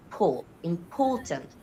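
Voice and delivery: "poor" alone, then important as im-POR-tant; stress on middle syllable. Shown as a demonstration. Student isolating stressed syllable, POR in important stressed. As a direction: slowly punch the POR in important. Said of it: "Poor is the most stressed one".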